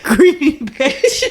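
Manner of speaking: slow, drawn out through laughter; laughing. Real, not acted. Laughing joke.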